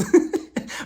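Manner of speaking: short breathy laugh; a quick amused laugh; burst of laughter. Real, not acted. Laughing at reality TV drama.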